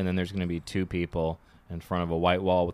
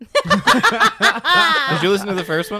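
laughing; 12 semitones higher, 8 dB louder than usual. Real, not acted. Laughing question.

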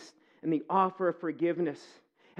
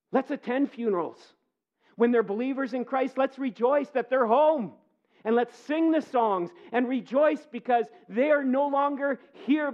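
preaching; 6 semitones higher, 3 dB louder than usual. Acted. Pastor exhorts the congregation.

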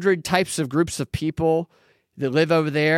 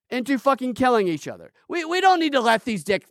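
ordinary delivery

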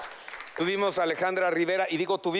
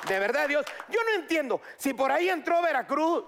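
excited rant; 7 semitones higher than usual. Real, not acted. Excited rant after joke.

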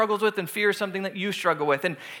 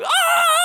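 very high, loud sustained scream; hammed-up terrified shriek; group scream; 6 dB louder than usual. Imitating everyone as the spider falls, high mock scream. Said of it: "of course we're going".

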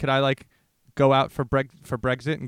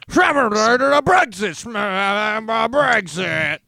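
high, loud garbled politician bluster; 8 semitones higher, 7 dB louder, much slower than usual. Acted. Parodying politicians saying Brexit a bunch, loud mock bluster. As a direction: shout mushy nonsense around the key word. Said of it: "politicians just saying the word Brexit a bunch".

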